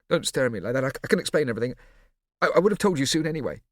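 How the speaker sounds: flustered. A narrator's reading. Flustered Scipio stammering.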